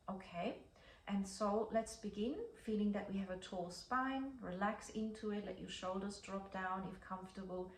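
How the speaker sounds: soft, unhurried guided breathing voice; slow breath counting. Acted. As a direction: count the breaths slowly and gently. Leads a breathing exercise.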